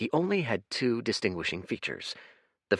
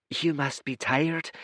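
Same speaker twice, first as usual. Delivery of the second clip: higher but gentle, calm and apologetic; 8 semitones higher than usual. Acted. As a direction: soothe the guest. Blue one smiles.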